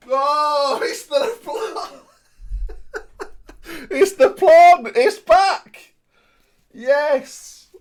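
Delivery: high squealing laughter at the find; delighted laughing. Real, not acted. Finds the plant again.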